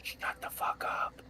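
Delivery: playful whispered jab; whispering. Real, not acted. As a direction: hiss it under your breath. Teasing players over open mic.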